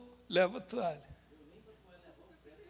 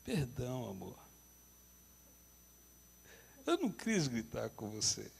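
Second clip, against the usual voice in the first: meek apology; 9 semitones lower, 11 dB quieter than usual. Retold or imitated. Husband apologising meekly.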